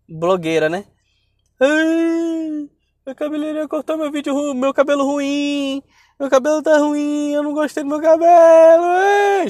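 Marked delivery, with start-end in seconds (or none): 1.6-9.5 mock crying. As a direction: imitate a blogger bawling over her hair. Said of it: "Aí ela postou um vídeo chorando". (choro fingido, agudo e exagerado)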